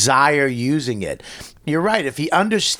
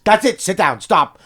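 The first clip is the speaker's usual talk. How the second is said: raised-voice alarm, retold; urgent parental command; 4 semitones higher, 4 dB louder, faster than usual. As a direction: call a kid back from danger. Dad stopping son on the roof.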